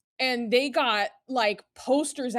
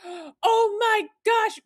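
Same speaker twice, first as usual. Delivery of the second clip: high, slow, amazed "oh my gosh"; excited kid voice; 10 semitones higher, 6 dB louder, much slower than usual. Retold or imitated. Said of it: "he was like".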